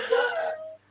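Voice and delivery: tearful, high break on "but"; tearful reluctance. Real, not acted. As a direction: let the upset burst out, then sink. Tearful about leaving, says she must.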